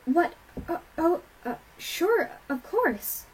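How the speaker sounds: flustered, high, broken-up reply; stammering. A narrator's reading. Twilight letting them in after shocking news, stammering. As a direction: stumble over filler words, pitch jumping. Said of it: "Twilight stammered".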